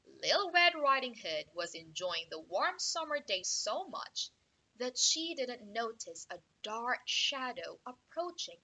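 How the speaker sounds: ordinary delivery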